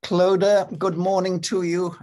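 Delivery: ordinary delivery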